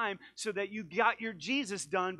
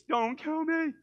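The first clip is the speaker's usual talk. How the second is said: high, loud mock panic; mock terrified plea; 12 semitones higher, 10 dB louder, slower than usual. People flat on their face before God, mock terrified. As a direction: beg for your life, hammed up. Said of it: "people are, like, flat on their face".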